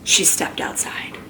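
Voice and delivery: soft whispered narration. Acted. Narrating Mercy slipping out the door, whispered. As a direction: whisper it like sneaking out. Sneaking out.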